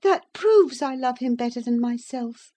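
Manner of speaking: put-on high-pitched girlish pleading; earnest young lady. Young lady assuring the narrator of her love, earnest. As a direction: protest devotion in a high voice.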